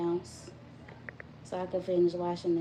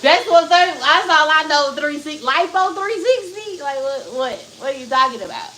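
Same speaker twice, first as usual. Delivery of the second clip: loud exasperation; 5 semitones higher, 11 dB louder than usual. Real, not acted. Exasperated rant about lipo.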